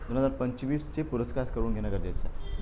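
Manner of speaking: ordinary delivery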